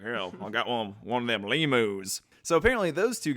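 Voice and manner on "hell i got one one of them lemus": put-on hillbilly accent.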